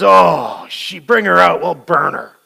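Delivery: loud, indignant mock condemnation; self-righteous outrage. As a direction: sneer like a smug judge. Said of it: "He goes".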